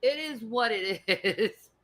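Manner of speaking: loud, drawn out, dissolving into laughter; laughing. Real, not acted. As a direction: crack up as you say it, high and loud. Cracks up about own accent.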